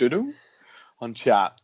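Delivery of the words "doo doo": high two-note doo-doo, questioning; hum-like guess at an alert sound; imitated messenger tone. Podcaster asking co-host about the old chat tone, imitated chime. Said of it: "what was the tone like".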